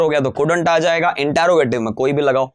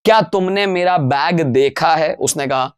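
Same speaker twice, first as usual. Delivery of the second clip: ordinary talk, nothing marked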